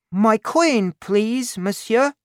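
put-on high-pitched child's plea; little boy. A narrator's reading. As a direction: ask for it in a small boy's high voice. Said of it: "said the boy".

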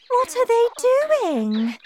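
high, loud, slow wail; drawn-out, high-pitched alarm; alarmed cry. Acted. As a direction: cry out like a horrified mother. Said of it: "cried Missus Salt".

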